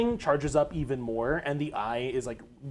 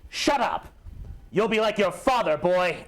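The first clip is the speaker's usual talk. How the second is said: high, raised villain's retort; angry shout back; 5 semitones higher, 3 dB louder than usual. Acted. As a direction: shout the fighter down. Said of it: "he shouts back at you".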